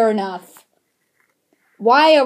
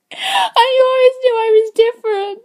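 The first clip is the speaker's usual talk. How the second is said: high-pitched, hammed-up sobbing revelation; tearful melodrama; 9 semitones higher, 6 dB louder than usual. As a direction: wail it like a soap-opera orphan. Melodramatic revelation scene, crying.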